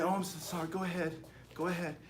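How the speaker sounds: timid apology. Retold or imitated. Timid person apologising to aggressor.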